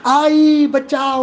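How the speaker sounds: high, slow, hammed-up wail for mother; a daughter's dramatic cry to her mother; mock panic. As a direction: whine for help like a child faking fear. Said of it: "उगाच नाटक करते".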